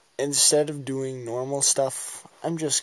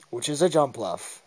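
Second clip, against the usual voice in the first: usual speaking voice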